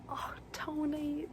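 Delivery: high, drawn-out mock Boston accent. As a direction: put on a slow, airy Boston accent. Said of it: "in his Boston accent".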